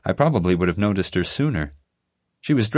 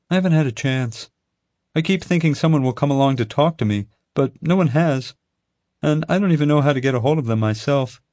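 put-on higher, a touch louder girl's voice; worried and a little helpless; young zookeeper Chloe; 7 semitones higher, 3 dB louder than usual. A narrator's reading. Chloe telling Teddy nobody from the FBI came, higher worried voice.

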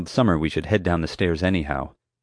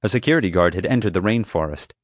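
usual speaking voice

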